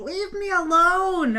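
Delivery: high, loud, drawn-out mock yell; imitated yelling. Imitating the neighbour yelling at nobody, mock yell. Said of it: "she s-, starts yelling".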